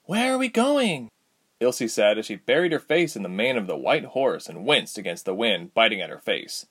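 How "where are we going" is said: put-on high, bright girl's question; young girl voice. A narrator's reading. Ilse voiced by narrator.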